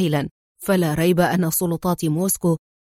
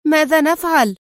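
louder, higher-pitched alarmed question; urgent cry; 7 semitones higher, 5 dB louder than usual. A narrator's reading. Woman running behind her partner cries out to him urgently. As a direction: call out in acted alarm. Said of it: "وهي تهتف".

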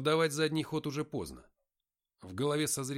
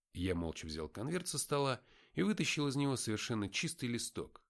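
ordinary delivery, nothing marked

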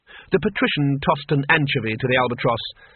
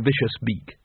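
usual speaking voice

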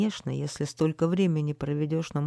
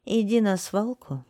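higher-pitched firm order; commanding; 6 semitones higher, 4 dB louder than usual. A narrator's reading. Lady sending garbage man to the dump, commanding. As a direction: give the order crisply. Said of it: "велела дамочка".

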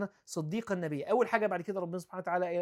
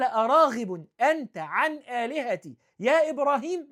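loud, high and slow, a stern father scolding; harsh rebuke; 5 semitones higher, 8 dB louder than usual. Retold or imitated. Father scolding Abraham over his gods, loud and high. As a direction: rebuke him, dragging out the name. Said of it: "مالوش يا بني حتى".

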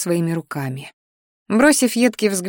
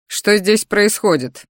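slow, deliberate demand; the boyfriend walking in and demanding answers, slow and stern; stern male voice; slower than usual. Acted. Kirian arrives, stern question.

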